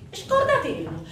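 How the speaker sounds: high, loud dismissal; shouted refusal. Acted. Brother refuses in acted quarrel.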